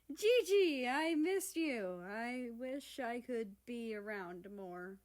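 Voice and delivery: slow, tender and loud at first; fond greeting. Acted. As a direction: greet her with real affection. America greets baby sister.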